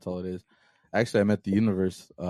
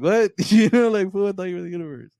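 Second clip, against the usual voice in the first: high-pitched retold disbelief with a laugh; incredulous; 11 semitones higher, 6 dB louder than usual. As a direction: laughingly act out total disbelief. Retold disbelief at stranger's claim.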